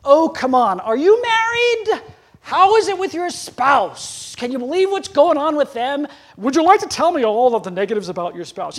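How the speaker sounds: high, loud mock-incredulous questioning; gentle challenge. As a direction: needle them playfully, slowing on the spouse. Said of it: "if I can gently say".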